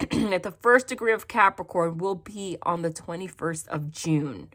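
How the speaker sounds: ordinary talk, nothing marked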